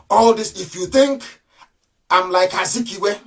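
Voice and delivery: usual speaking voice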